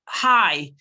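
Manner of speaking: loud, eager "Hi"; excited greeting. Shown as a demonstration. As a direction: greet back with energy. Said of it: "people will".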